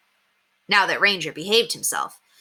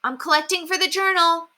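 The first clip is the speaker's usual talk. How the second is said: put-on boy calling up; 12 semitones higher than usual. A narrator's reading. Henry calling up to window.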